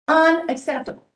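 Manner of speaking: loud, indignant single word; angry outburst. Real, not acted. Official scolding the county over turnout, loud indignant. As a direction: slam the word down in real anger. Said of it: "I'm a little emotional".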